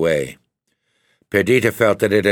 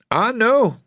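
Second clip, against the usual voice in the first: high, spirited protest; spirited cry; 3 dB louder than usual. A narrator's reading. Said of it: "cried Perdita with spirit".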